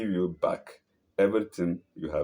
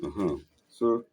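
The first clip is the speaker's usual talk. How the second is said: plain reading voice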